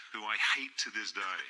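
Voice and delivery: ordinary delivery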